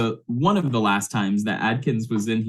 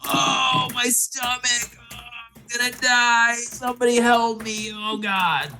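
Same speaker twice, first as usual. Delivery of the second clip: high, drawn-out wailing cries for help; hammed-up agonized patient, losing his mind; screaming in agony; 13 semitones higher than usual. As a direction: wail as if your gut is killing you. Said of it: "he is just screaming in agony".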